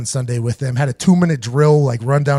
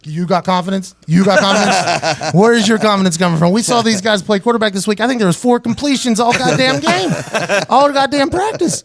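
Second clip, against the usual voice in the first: mock-outraged, climbing steadily higher; incredulous rant; 5 semitones higher than usual. Player challenging coach about confidence, rising incredulous outburst. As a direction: build from disbelief to a high-pitched protest.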